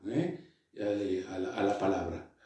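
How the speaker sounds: ordinary talk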